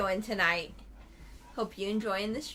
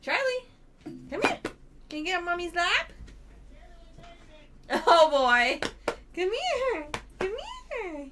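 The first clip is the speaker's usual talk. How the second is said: talking to a dog; 9 semitones higher, 4 dB louder than usual. Real, not acted. Coaxing dog onto lap.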